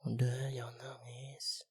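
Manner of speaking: slow tender whisper; calm her tears slowly; slow hushed comfort; soothing whisper. Acted. Comforting the crying listener.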